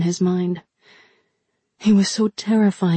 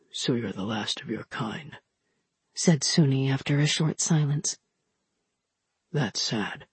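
deep quiet giant; 5 semitones lower, 7 dB quieter than usual. Acted. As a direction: rumble it softly like a gentle giant. Suni voiced deep and soft.

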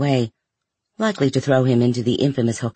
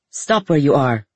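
shouting. A narrator's reading. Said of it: "shouted the captain".

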